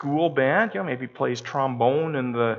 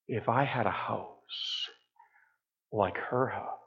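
slow, soft and low; hushed longing; 5 semitones lower, 11 dB quieter, slower than usual. Pretend. Preacher voicing covetous thoughts about a neighbour's house, hushed longing. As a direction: muse on it with quiet envy.

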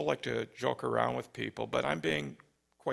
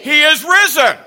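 much higher, much louder shout; through the roof; 12 semitones higher, 17 dB louder than usual. Real, not acted. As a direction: blow the roof off. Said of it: "through the roof".

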